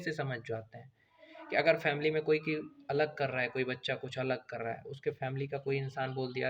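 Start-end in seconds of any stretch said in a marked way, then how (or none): none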